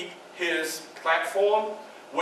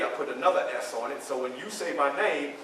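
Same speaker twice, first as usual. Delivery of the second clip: usual speaking voice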